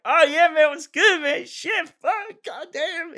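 lazy surfer dude drawl, way up high; Zen voice. Shown as a demonstration. Voice actor demos his alternate audition take as stoner Zen. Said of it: "I did my take as Zen".

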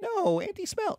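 put-on high-pitched little kid refusal; protesting child. Child refusing parent's request to kiss auntie, high whiny voice. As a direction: whine the objection up high. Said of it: "And you're like".

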